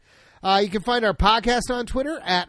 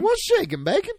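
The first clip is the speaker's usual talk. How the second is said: much higher, bouncy greeting; high squeaky greeting; 13 semitones higher than usual. Shown as a demonstration. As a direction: pitch it way up, playful. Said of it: "That's what we'll say".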